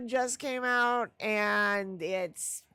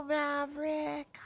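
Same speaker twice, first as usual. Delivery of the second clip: ordinary talk, nothing marked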